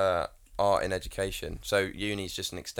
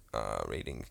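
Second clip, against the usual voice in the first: lower, softer and slow mock teenage voice; mocking his younger self's view of reading, sulky low teen voice; sulky teenager; 12 semitones lower, 10 dB quieter, slower than usual. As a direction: drag it out like a bored fifteen-year-old. Mocking his bored teenage self.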